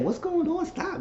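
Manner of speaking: squeaky high stop, playfully shocked; high mock protest. Imitating the girl pushing him off, high mock protest. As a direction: shriek it up high, half joking. Retold protest during humping.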